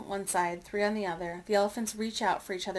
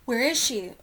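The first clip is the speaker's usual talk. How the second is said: louder, urgent searching question; 3 semitones higher, 7 dB louder than usual. A narrator's reading. Elephant searching for the sunken baby, urgent and louder. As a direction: ask it urgently, voice raised.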